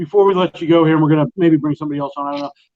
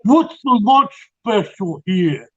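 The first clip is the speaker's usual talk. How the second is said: slow, higher-pitched mock New York mobster; [name]'s New York accent; 4 semitones higher, slower than usual. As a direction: order lunch as a hammed-up New York wiseguy. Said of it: "it's a stereotypical, uh, accent".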